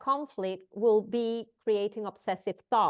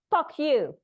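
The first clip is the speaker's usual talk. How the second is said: sudden loud, higher snapped curse; the baker snaps back in a loud, raised voice; angry retort; 6 semitones higher, 5 dB louder than usual. Retold or imitated. The baker curses at the customer, loud angry snap.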